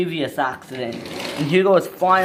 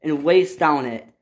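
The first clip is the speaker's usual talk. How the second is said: ordinary delivery, nothing marked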